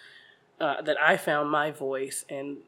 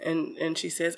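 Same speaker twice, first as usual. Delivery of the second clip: ordinary talk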